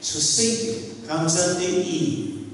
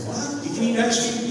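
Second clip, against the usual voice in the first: mock shocked evangelist; 8 semitones higher, faster than usual. Evangelist shocked by ice cream.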